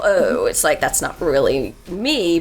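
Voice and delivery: usual speaking voice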